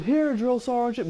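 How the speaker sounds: high, drawn-out recruit's call; recruit answering. Retold or imitated. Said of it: "when I said".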